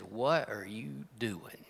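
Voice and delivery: slow, breathy hushed question; slow whisper. Acted. A preacher voicing God's quiet question to Elijah. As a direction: let it fall soft and slow like a gentle call.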